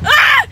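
high loud shriek; excited shriek. Real, not acted. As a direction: let out a sudden squeal. Laughing outburst.

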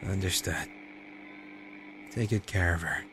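normal talking voice

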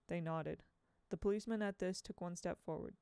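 ordinary delivery, nothing marked

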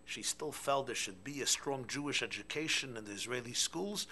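usual speaking voice

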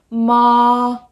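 MA drawn out on one high, level pitch; long flat high MA, no movement; even monotone. Shown as a demonstration. Teacher demonstrating the first tone, very even. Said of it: "very even".

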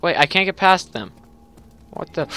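ordinary delivery

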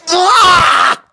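ordinary talk

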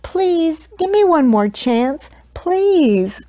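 high, plaintive begging; pleading. A narrator's reading. Baker begging the Duke to stay, high plaintive plea. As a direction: beg desperately, stretching each "please". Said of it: "pleaded Walter".

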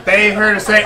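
normal talking voice

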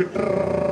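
rolled-tongue scooter engine noise; trilled motor sound; scooter rattle. Acted. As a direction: mimic a scooter buzzing past.

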